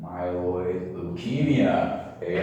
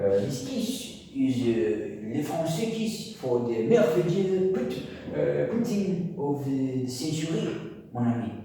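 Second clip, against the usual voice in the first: put-on playful, higher-pitched French accent; 6 semitones higher than usual. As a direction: slip into a jokey French accent. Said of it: "I'm doing a French accent".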